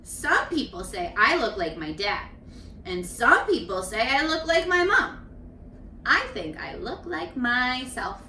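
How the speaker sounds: gentle storybook reading; reading to children. A narrator's reading. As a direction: read each child's page to the class. Teacher reads picture book aloud.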